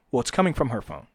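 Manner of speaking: usual speaking voice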